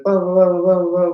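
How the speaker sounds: high, loud run of babble; loud, high-pitched blah-blah imitation; imitated French chatter. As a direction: mimic her incomprehensible stream of talk. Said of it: "all this French stuff".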